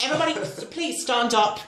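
high-pitched mock scolding. Acting [name] scolding the audience.

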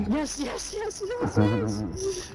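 high, thrilled yes yes; excited cheering. Real, not acted. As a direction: cheer it out, squealing with joy. Celebrating in the safe zone.

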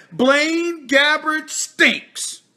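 drawn out very slowly, each word weighed; slow mocking quote. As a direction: let the verdict sink in slowly. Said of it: "My man Chris Carter always said".

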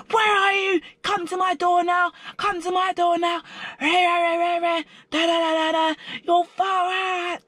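very high, screechy imitation of screaming; mock screaming. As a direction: mimic her screaming down the corridor, words turning to jabber. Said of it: "Just screaming like someone shot her mum".

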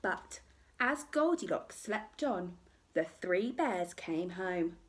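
usual speaking voice

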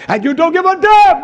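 loud, high cry on damn; preaching shout. Real, not acted. Preacher scolding the wicked in a loud, high shout. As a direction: blast the rebuke out. Peak of sermon rebuke.

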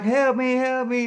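high, loud acted yelling; re-enacted high-pitched plea; desperate cry for help. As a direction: cry out to be rescued. Acts begging police for help.

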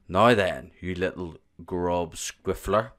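loud, slow bully giant; booming giant. A narrator's reading. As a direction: boom down at the little one. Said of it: "boomed the flesh lump eater".

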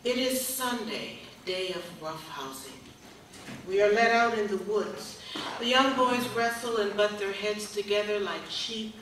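measured, projected poem recital, phrases set apart by pauses; poetry reading. Acted. Poet reads her slave-narrative poem to an audience, measured recital.

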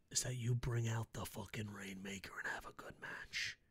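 hushed whisper of a humble peasant; whispered plea. Acted. Fan as little peasant whispering his request to Okada. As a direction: beg softly like a lowly peasant.

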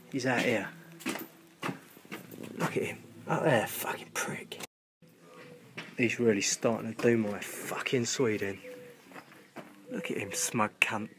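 a soft seething whisper; hushed whisper. Real, not acted. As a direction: hiss it so the bird won't hear. Whispering about pigeon outside.

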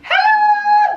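very high, loud hello; excited shout. Real, not acted. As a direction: squeal the greeting. Child greeted with channel intro.

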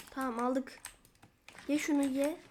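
ordinary talk

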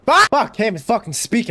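flustered, exasperated cursing; getting mad. Real, not acted. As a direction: burst out cursing as the words fail you. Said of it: "I'm getting mad".

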